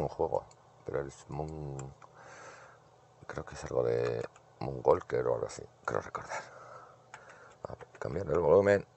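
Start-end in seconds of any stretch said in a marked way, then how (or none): none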